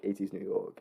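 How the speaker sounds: ordinary talk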